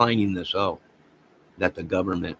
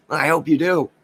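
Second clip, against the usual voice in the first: laughing it out, higher and louder; 7 semitones higher, 7 dB louder than usual. Real, not acted. Laughing back at the sheriff over the vigilantes, higher and louder. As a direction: laugh through the words.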